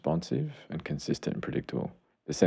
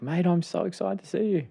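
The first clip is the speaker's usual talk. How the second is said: put-on much higher, bright excited welcome to a kid; excited greeting. Therapist greeting a young boy, excited. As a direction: greet him like you're thrilled he came. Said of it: "I'm like".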